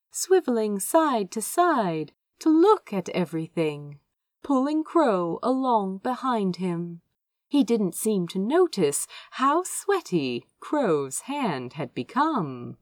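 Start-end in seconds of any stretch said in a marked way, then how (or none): none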